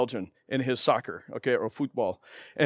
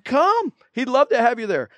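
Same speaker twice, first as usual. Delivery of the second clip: eager invite; 8 semitones higher than usual. Retold or imitated. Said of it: "I'm like".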